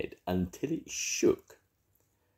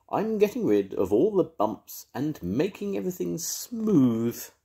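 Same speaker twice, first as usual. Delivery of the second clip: higher, cheery dog voice, pleased with himself; a dog boasting about his work; Rhubarb the dog, proud; 10 semitones higher than usual. Acted.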